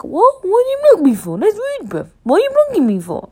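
very high, loud incredulous squawk; mock indignant. Podcaster retelling being blocked on WhatsApp, in mock high-pitched outrage.

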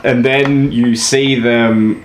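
ordinary delivery

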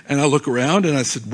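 ordinary talk, nothing marked

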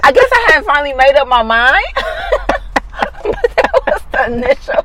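high, breaking with laughter; laughing hard. Real, not acted. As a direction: squeeze the words out through giggles. Laughing through the honey confession.

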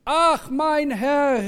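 high, loud, long-drawn cry of dismay; dramatic storyteller's outcry. A narrator's reading. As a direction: wail it out slowly in alarm. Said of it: "rief er, schrie er".

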